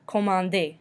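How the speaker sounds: Commander as French koh-mahn-DAY; silent final R. Shown as a demonstration. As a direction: say Commander ending in an AY, no R. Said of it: "We do not pronounce the R".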